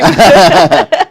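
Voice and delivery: loud, high burst of laughter; sudden laugh. Real, not acted. Interviewer laughing at her heartbroken reaction. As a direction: break into a laugh.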